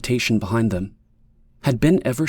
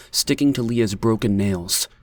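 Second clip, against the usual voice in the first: ordinary delivery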